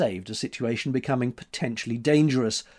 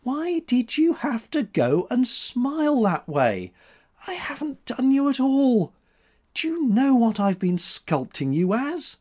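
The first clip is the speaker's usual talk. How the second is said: put-on a lively young woman scolding; heroine's voice; 12 semitones higher, 4 dB louder than usual. A narrator's reading. Heroine scolding her sitter.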